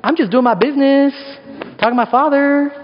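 put-on high, offhand teen reply; breezy teenager. Teen Jesus answers his parents, mock nonchalant. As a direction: toss it off like a carefree teen.

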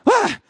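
very high imitated child's shriek; mock startled yelp. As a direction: yelp like an alarmed little girl. Imitates daughter's startled cry.

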